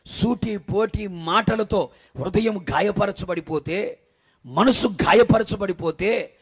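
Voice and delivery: loud, slow, building sermon; slow loud preacher's lament; preaching at full force. Acted. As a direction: hammer home her grief from the pulpit. Preaches the wife's suffering.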